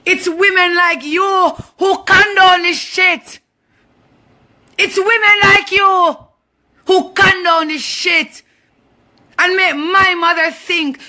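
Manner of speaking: real, high-pitched angry shout; angry confrontation. Real, not acted. Angrily confronting the woman online.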